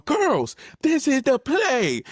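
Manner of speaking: high mock coach voice, slow on the play; a little girl playing coach; daughter mocking coach dad. Daughter imitating her dad coaching, high and slow. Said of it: "was just talking like I do".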